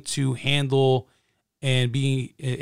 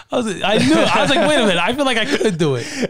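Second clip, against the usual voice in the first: laughing; 8 semitones higher, 4 dB louder than usual. Real, not acted. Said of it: "laughing at being tricked".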